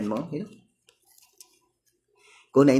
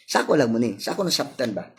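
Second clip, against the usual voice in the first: ordinary talk, nothing marked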